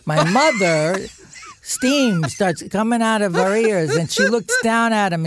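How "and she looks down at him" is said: normal talking voice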